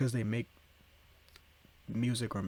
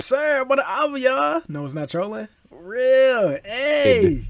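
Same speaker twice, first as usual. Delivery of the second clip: much higher and louder, hyped yelling; hyped shouting; 13 semitones higher, 12 dB louder, slower than usual. Real, not acted. Streamer hyped about being on the album, shouting. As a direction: yell it like your name just got called.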